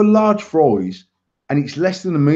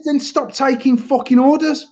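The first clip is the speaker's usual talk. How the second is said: higher-pitched mock snap at the manager; retold angry retort; 9 semitones higher than usual. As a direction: fire it back, pitch raised. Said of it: "five minutes of abusing him".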